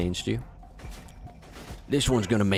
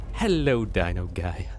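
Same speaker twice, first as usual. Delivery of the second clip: ordinary talk, nothing marked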